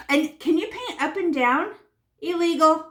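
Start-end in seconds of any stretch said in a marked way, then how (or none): 2.2-2.9 mock stern. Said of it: "mock rule for brush strokes".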